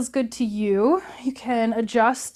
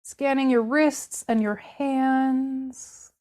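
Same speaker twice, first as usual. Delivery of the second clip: guided body scan; 2 semitones lower, 3 dB quieter than usual. Acted. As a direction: guide them gently through the body, leaving room to feel. Continues the body scan meditation.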